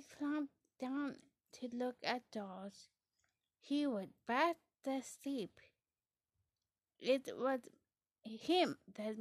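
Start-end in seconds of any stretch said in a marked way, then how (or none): none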